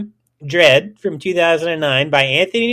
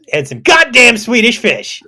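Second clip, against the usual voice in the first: loud, high, hyped candy plug; emphatic exclamation; 9 semitones higher, 8 dB louder than usual. Pretend. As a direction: blurt it out with mock vehemence. Mock-emphatic candy announcement.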